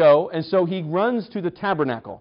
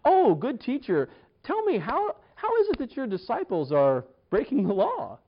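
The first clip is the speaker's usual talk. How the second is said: mock-sweet smugness; 6 semitones higher, 4 dB quieter than usual. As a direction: ask like a teacher's pet setting a trap. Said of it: "just the smugness in there".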